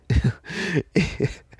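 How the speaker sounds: loud burst of laughter; pained laughter. Real, not acted. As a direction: laugh hard though it hurts. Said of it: "it hurts to laugh".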